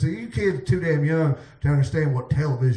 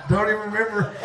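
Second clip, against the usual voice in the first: laughing; 3 semitones higher, faster than usual. Real, not acted. Laughing after drunk slip.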